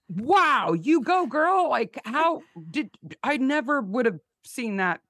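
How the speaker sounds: high, loud gushing disbelief; amazed cheering. Retold or imitated. Host marvelling at listeners who spot missed details, amazed.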